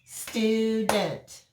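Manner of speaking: "Student" split into two even beats, stu and dent; "Student" broken slowly as stu, dent; clapped out syllables. Shown as a demonstration. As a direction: clap out "Student", each syllable given full length. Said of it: "Let's clap it out".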